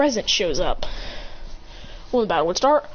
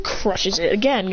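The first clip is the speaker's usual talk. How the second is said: plain reading voice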